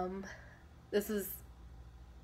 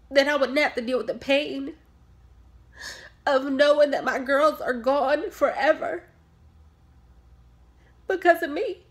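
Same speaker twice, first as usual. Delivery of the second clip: pained guilt; 3 semitones higher, 5 dB louder than usual. Acted. Mother telling doctor her girls are gone, pained. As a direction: voice rising with grief. Mother blames herself for deaths.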